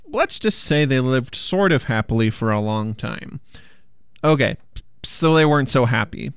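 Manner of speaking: usual speaking voice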